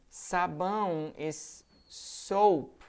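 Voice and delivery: slow down on "Sabão is soap", each word apart; slow careful contrast. Shown as a demonstration. Teacher warning students not to confuse soap and soup, slowly. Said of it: "be careful here".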